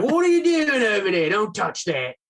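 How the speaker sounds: put-on high, loud Australian accent, laughing through it; cannibal chef Australian voice. Haunt actor as Australian cannibal chef, loud and high. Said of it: "I was a cannibal chef".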